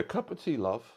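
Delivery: put-on high-pitched playful British accent; hammed-up British tea-time voice. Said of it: "My British accent is actually getting pretty good".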